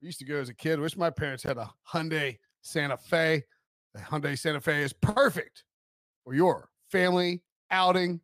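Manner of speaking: smooth radio ad voice; ad read. Acted. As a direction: read it like a car commercial. Recorded car ad read.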